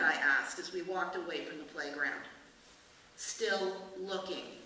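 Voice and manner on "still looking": slow, drawn-out monotone. Acted. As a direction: say it slowly with no life in it. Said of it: "said the policeman in a monotone".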